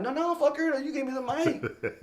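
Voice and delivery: high, laughing, playful defiance; giggling keep-away, voice way up; laughing refusal. Retold or imitated. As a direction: hold the mic away and tease him. Refusing to return the mic.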